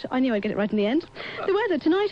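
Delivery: usual speaking voice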